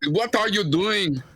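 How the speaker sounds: mock Austrian accent, bark it like an action movie; Austrian action hero accent. Friend mocks the villain's German accent as Austrian. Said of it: "This dude's German accent sounds very Austrian".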